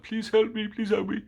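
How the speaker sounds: high, squeaky mock plea for help; pleading boss. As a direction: squeal it like a panicking coward. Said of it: "So he's like".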